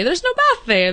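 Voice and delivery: lightly voiced puzzled Aussie; imitating locals at a bathroom request; mimicking locals. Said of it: "they're like".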